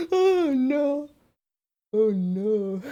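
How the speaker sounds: high drawn-out moan through laughter; laughing lament. Real, not acted. Creator looking at the ruined prop, laughing helplessly. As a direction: wail weakly as the laughter takes over.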